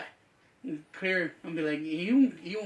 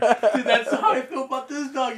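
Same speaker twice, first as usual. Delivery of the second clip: louder, higher, laughing agreement; 7 semitones higher, 9 dB louder, slower than usual. Real, not acted. Friend laughing in agreement about the annoying dog. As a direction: crack up while agreeing.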